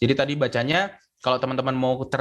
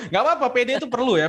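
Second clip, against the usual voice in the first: laughing; 9 semitones higher, much faster than usual. Real, not acted. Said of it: "teacher laughs at student".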